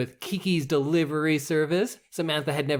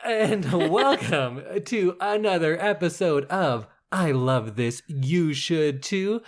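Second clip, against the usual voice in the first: podcast host intro; 4 semitones higher, slower than usual. Acted.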